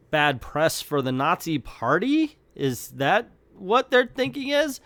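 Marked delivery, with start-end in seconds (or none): none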